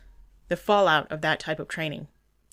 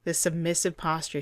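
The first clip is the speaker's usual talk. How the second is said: ordinary talk, nothing marked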